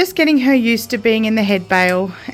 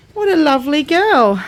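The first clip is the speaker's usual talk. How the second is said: cooing praise; 6 semitones higher than usual. Real, not acted. Praising cow.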